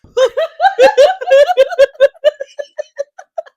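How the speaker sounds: long, high, loud laughter. Real, not acted. Long laugh at Dune joke.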